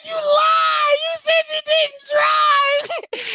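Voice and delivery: put-on very high, drawn-out child's wail; retold shrieked accusation. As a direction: accuse mom in a hammed-up shriek. Retold screaming child accuses mother.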